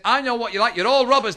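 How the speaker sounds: indignant accusation. Acted. Liverpool shopper accuses the Harrods salesman, loud and indignant. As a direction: accuse him of robbery, raising your voice.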